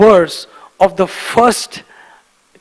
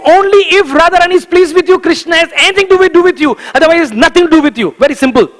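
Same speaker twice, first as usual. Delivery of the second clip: raised, insistent preaching; fervent sermon emphasis; emphatic preaching; 8 semitones higher, 4 dB louder than usual. Real, not acted. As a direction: drive the point home hard. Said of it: "very clearly saying".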